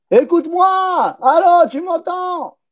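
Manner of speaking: high, drawn-out mock call; exaggerated high-pitched plea to be heard. Acts out calling to be heard.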